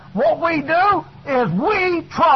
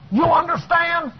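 ordinary delivery

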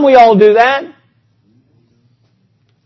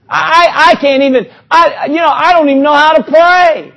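higher, louder, near tears; anguished pleading; 5 semitones higher, 4 dB louder than usual. Acted. Preacher voicing someone who doesn't know how to pray, tearful. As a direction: plead as if overwhelmed by grief.

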